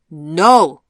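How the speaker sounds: very loud, forceful outburst; vehement interjection. A narrator's reading. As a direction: cut in sharply and loudly. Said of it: "interjected the old woman vehemently".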